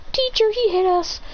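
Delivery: high-pitched schoolkid complaint; tattling pupil. Acted. Pupil telling Teacher he was hit, high-pitched tattling.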